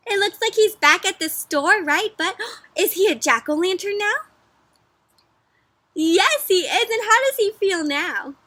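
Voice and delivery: excited reveal. Acted.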